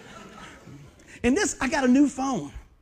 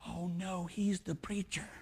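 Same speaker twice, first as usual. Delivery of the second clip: much softer, slow dismayed aside; a mourner realizing the preacher is his old friend; hushed aside; 4 semitones lower, 11 dB quieter, slower than usual. Retold or imitated. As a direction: mutter it under your breath in dismay. Guy realizing who preaches.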